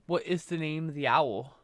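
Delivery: ordinary delivery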